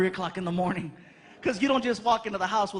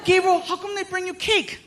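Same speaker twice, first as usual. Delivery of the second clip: put-on much higher curious kid; Frankie voice; 9 semitones higher, 4 dB louder than usual. Frankie asking his dad, much higher. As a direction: ask it like a curious child. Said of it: "Frankie asks".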